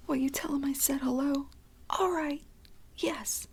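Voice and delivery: mother still screaming at her son as he leaves, in a high hushed shout; whispered screaming. Acted. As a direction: keep screaming at him under your breath. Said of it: "Still screaming".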